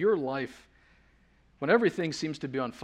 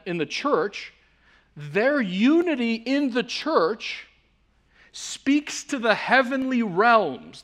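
higher, louder, slowing on key phrases; full-force preaching; 6 semitones higher, 9 dB louder than usual. Acted. Pastor proclaiming church unity to congregation, full-force preaching. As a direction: build it up like a preacher at full power.

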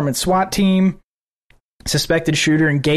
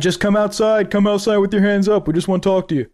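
lightly voiced officer, pitched higher; police calling out; 3 semitones higher than usual. Host retelling police orders to the man in the trailer, mildly called out. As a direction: imitate police calling someone out, mildly raised. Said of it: "the police, you can hear him outside".

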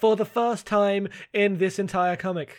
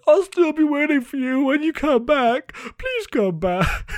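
higher, slow, pathetic whine; mock pleading; 9 semitones higher, slower than usual. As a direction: ham up a dumped man begging. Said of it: "He's like".

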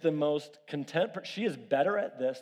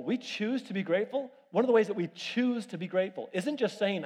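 usual speaking voice

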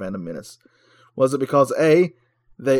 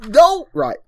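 high Homer-style do right; Simpsons voice; 11 semitones higher, 5 dB louder than usual. Retold or imitated. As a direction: squeak it out like a Simpson. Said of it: "Like you're a Simpson?".

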